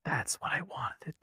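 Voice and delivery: whispered secret; breathy secret whisper, slow. Real, not acted. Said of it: "Can I tell you guys a secret?".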